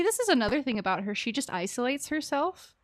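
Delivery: normal talking voice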